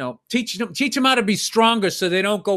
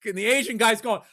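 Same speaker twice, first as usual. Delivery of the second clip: ordinary talk, nothing marked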